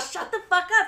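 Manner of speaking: high, loud stunned exclamation; amazed disbelief. Retold or imitated. She reacts to the soccer mom's poster reveal, high amazed shout. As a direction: blurt it out in delighted shock. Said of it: "I was like".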